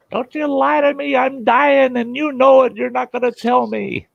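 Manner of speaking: high, loud accusing cry; mock angry patient. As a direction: imitate a patient accusing the crew of lying. Dying patient accusing rescuer.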